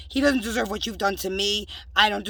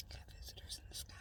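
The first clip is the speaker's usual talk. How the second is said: whisper; 25 dB quieter, faster than usual. Real, not acted. Whispered aside about sky.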